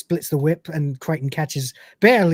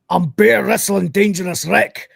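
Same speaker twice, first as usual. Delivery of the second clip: loud, higher-pitched Scottish bellow; boastful Scottish hard-man threat; giant Scotsman wrestler; 4 semitones higher, 4 dB louder than usual. Acted. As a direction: roar a wrestling catchphrase in broad Scots. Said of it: "invented a giant Scotsman character".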